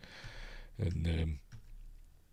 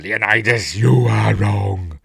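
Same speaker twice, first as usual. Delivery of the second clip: louder, slow, drawn-out condemnation; mock bitter accusation; 8 dB louder, slower than usual. Ephialtes cursing Leonidas, slow loud mock accusation. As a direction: drag out each word in mock fury.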